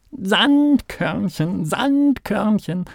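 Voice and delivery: hoher, gedehnter Ruf; trompetend. A narrator's reading. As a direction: langsam und hoch hinausposaunen. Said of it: "trompetete Kalle". (fröhlich trompetender Maulwurf)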